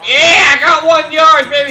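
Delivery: slow, loud triumphant shout; excited cheer. Real, not acted. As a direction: gloat over the small win. Celebrating a gained yard.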